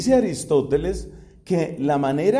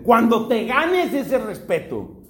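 high, slow theatrical comeback; hammed-up indignant reply, pitched up; acted angry retort; 6 semitones higher, slower than usual. Brother answering his sister's shout in rehearsal, angry retort. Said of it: "el otro responde".

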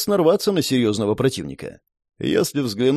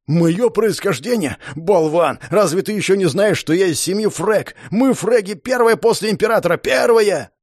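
higher, shouting, swollen with pride; arrogant noble brat, shouting; 6 semitones higher than usual. Acted. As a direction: yell it like a pompous brat. Dors boasts angrily.

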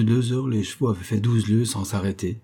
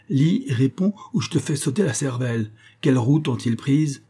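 high, forceful, threatening demand; serious threat; 6 semitones higher than usual. A narrator's reading. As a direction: demand an answer at pistol point. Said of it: "D'Artagnan parlait sérieusement". (menace ferme, voix haute)

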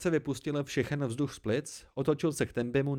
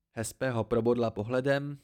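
ordinary delivery, nothing marked

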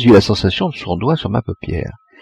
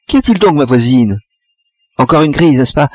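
put-on old neighbour calling out; 6 semitones higher, 4 dB louder than usual. A narrator's reading. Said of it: "une voix s'est écriée".

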